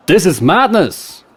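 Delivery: loud, high outraged shout. Acted. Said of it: "Rolf shouted out".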